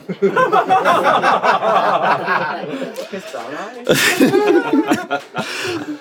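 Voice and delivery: high laughter that keeps rolling; can't stop laughing at the story; long laughing fit. Real, not acted. Reader laughing at the soulful butt eye, a long laughing fit.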